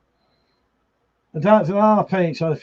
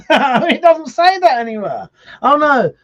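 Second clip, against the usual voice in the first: high, loud, real laughter through the words; laughing protest; 10 semitones higher, 6 dB louder than usual. Real, not acted. Laughing at a misread comment.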